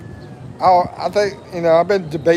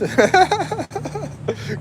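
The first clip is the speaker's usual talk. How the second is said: high giggle; 14 semitones higher, 3 dB quieter than usual. Real, not acted. Laughing at his misreading.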